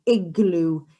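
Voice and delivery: normal talking voice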